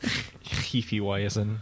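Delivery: Hefe Weizen drawn out very slowly; German pronunciation. Shown as a demonstration. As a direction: Hefe Weizen slow, spitting the h. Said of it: "full wad of spit in your mouth".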